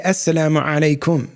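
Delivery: "Assalamu alaikum" with Arabic sounds, strong ayn; Arabic pronunciation. Shown as a demonstration. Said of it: "that's the Arabic way of pronouncing it".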